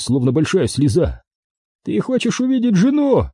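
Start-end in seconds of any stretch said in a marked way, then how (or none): 1.8-3.3 high-pitched voiced threat, no whisper; hissed threat. A narrator's reading. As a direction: taunt the captive in a raised pitch. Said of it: "прошипел Уолтон". (угроза высоким голосом)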